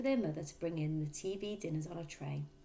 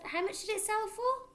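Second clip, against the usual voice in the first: put-on a boy checking his notes; son. A narrator's reading. Son asking his father to repeat the price, higher.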